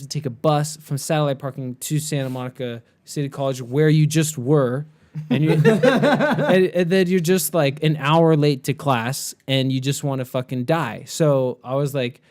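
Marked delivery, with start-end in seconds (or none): 5.7-6.6 high, loud laugh burst; burst of laughter. Real, not acted. Laughs recalling the bus.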